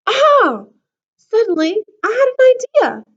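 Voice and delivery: bright burst of discovery; excited. A narrator's reading. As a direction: lit up with a sudden idea. Sudden idea in story.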